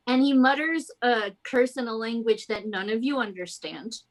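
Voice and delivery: ordinary talk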